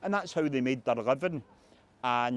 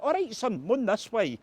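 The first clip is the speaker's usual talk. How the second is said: much higher, matey Glasgow voice; friendly escort; hardman turned friendly; 9 semitones higher than usual. Retold or imitated. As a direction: switch from threat to welcome. Hardman softens on hearing Bobby Lynn.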